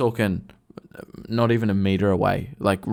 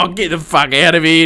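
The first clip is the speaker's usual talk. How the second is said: loud, raised retold protest; disgusted yell; 6 semitones higher, 11 dB louder than usual. Storyteller voicing his tradesman under attack from a cat, loud protest. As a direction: yell it like you're fleeing the mess.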